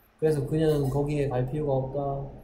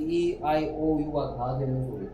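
ordinary delivery

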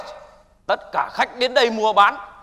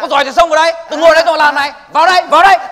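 higher, louder jeering dare; daring shout; 5 semitones higher, 6 dB louder, faster than usual. Acted. Addict dares the veterans to come at him, shouting. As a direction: goad them to charge in, yelling.

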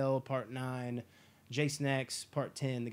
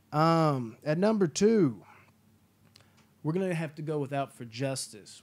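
ordinary talk

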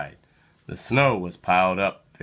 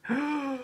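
quick high intake of breath; sharp gasp of discovery; 9 dB quieter than usual. A narrator's reading. Reader to child, gasping at the find. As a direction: gasp as if spotting treasure. Peter finds something in snow.

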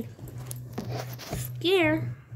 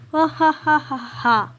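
loud villain ha ha ha; evil cackle; 3 dB louder than usual. Pretend. Villain laugh after boast.

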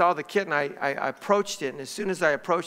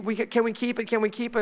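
put-on higher, repeated kid pleading; imitating begging children; 6 semitones higher than usual. Daughters begging Dad to keep the kitten, higher mock pleading. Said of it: "they begged me".

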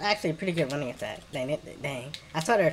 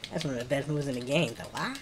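usual speaking voice

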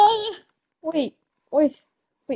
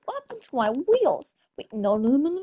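ordinary delivery

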